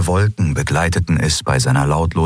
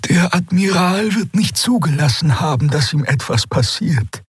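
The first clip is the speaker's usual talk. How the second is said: Xea's voice; 10 semitones higher than usual. A narrator's reading. Xea reassures, voiced figure.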